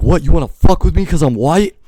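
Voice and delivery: high, loud shout, fire in it; furious challenge. Retold or imitated. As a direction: bellow it like the fire of roaring lions. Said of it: "I'm like".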